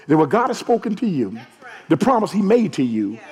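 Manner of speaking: ordinary delivery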